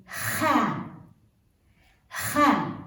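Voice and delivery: kha with a rasping throat kh like Nacht; kha, raspy German ch sound; Arabic kha. Shown as a demonstration. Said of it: "like the German sound in Nacht".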